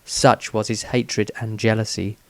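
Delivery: plain reading voice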